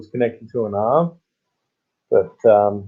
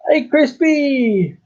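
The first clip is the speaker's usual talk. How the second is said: much higher, slow, stretched "Hey, Crispy!"; drawn-out call; 14 semitones higher, much slower than usual. Pretend. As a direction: call out long and high to a viewer. Calling out to a viewer.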